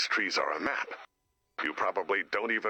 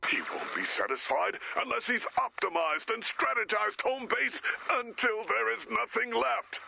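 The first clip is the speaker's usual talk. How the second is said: manic rising rant; 14 semitones higher than usual. Acted. Mounting frenzy.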